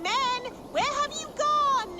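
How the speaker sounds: high, carrying call to someone out of sight. Acted. Old woman calls for missing helper.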